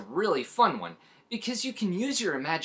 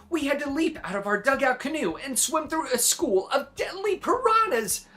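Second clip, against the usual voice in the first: excited build; 10 semitones higher than usual. A narrator's reading. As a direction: voice soaring to the scary word piranhas. Thrilling piranha story climax.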